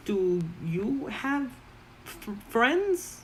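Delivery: high, slow goblin question; curious goblin. Acted. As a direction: ask it slowly, pitch climbing. Goblin Momo asks shyly.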